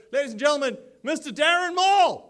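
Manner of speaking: ring-announcer style build to the name; building announcer call. Acted. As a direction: build the intro to a crowd-pleasing peak. Announcing the MC onstage.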